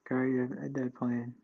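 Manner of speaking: ordinary delivery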